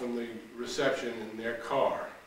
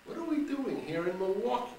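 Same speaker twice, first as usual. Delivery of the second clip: mocking echo; 10 semitones higher than usual. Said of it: "Like you said".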